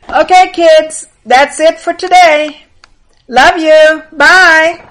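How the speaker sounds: high, loud, drawn-out farewell; cheery called-out sign-off. Real, not acted. Host signing off to the kids, calling out cheerily. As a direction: sing out a big goodbye.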